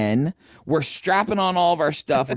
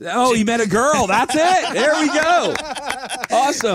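excited cheer; 9 semitones higher than usual. Real, not acted. Cracks the joke, celebrating.

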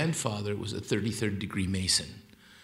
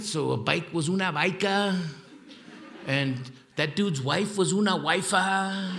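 Tijuana Spanglish; 7 semitones higher than usual. Shown as a demonstration. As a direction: say "una bica" and "una wifa" the Tijuana way. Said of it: "I talk Spanglish too".

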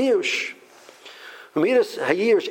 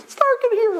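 tiny, very high seed voice; playful high-pitched complaint from a little seed; little seed complaining; 4 dB louder than usual. Acted. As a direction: squeak it out as a buried seed. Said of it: "and the seed says".

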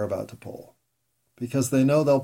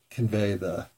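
ordinary delivery

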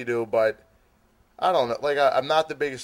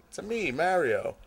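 soft, higher, half-hearted Italian accent; South Philly Italian accent; 6 semitones higher, 5 dB quieter than usual. Shown as a demonstration. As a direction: try a light South Philly Italian lilt. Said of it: "I'll do a South Philly Italian accent".

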